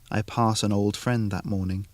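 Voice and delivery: plain reading voice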